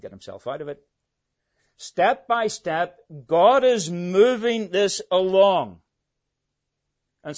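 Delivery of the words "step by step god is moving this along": loud, slow, high proclamation; emphatic preaching. Real, not acted. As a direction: drive each word home slowly at full voice. Preacher builds to emphatic point.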